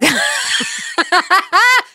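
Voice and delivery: laugh. Real, not acted.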